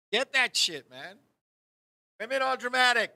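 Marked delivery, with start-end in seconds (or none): none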